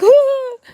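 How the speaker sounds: sudden high loud squeal; excited shriek. Shown as a demonstration. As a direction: shriek like the stream just went live. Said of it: "I get on live and I'm like".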